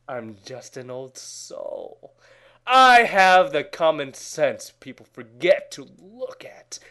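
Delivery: normal talking voice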